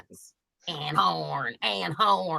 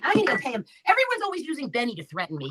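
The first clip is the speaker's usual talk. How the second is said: usual speaking voice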